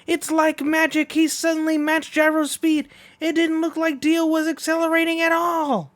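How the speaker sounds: high, loud play-by-play; race commentary. Acted. Announcer calling the race as Gyro pulls ahead, excited. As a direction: call the race like it's neck and neck.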